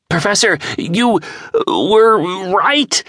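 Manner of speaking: high, breathless rush of excitement; bursting to share the news; excited. A narrator's reading. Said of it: "said James excitedly".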